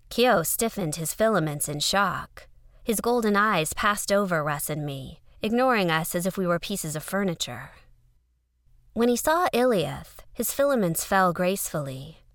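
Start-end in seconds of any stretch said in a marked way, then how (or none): none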